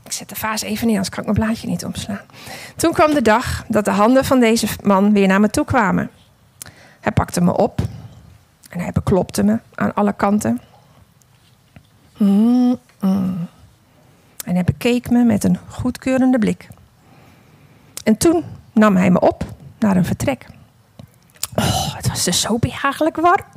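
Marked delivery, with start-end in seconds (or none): 2.8-20.5 unhurried storytelling voice, read as the clay vase; story narration. A narrator's reading. Preacher telling the congregation a parable, in storytelling voice. 21.5-23.4 whisper. A narrator's reading. Vase savours the cosy warm room.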